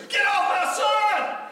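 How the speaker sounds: very high, slow, loud shriek; screaming mother. Retold or imitated. Mother shrieking at the man on her son, high and slow.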